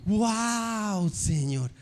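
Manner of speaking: mock amazement; admiring passerby. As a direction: play an impressed onlooker, stretched out. Acted admiring passerby in the story.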